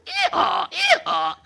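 very high ee-haw bray; donkey bray. Retold or imitated. Animals cheer together, very high bray. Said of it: "And all the animals say".

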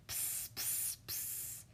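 three soft hisses in a row; string of unvoiced pssts; baby's repeated psst. A narrator's reading. Baby will not rest, repeated soft psst. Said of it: "It said".

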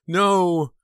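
very high, loud cry of dread; panicked high yell; terrified. A narrator's reading. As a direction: cry out in terror at a ringing phone.

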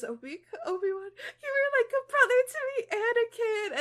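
high, loud tearful wail; crying. Acted. Dramatic Obi-Wan farewell reenacted.

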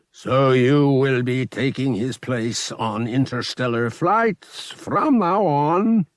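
a powerful priest granting a favor, unhurried; imposing alien high priest. Acted. Alien high priest assigning his new pilot, slow and imposing.

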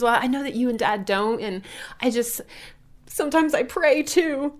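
imitating a sheepish daughter; shy daughter. Daughter admitting she prays, high shy voice with a laugh. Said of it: "She goes".

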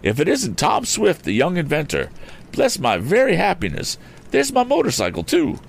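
high-pitched, excitable eccentric gentleman; Mr. Damon exclaiming. A narrator's reading. Odd gentleman agrees to help the young inventor, exclaiming. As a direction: burst out high with delighted surprise. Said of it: "exclaimed the odd gentleman".

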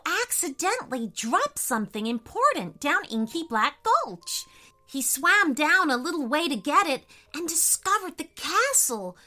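high, eager little squid; bubbly gossiping sea creature; excited squid voice. A narrator's reading. Squid character voice.

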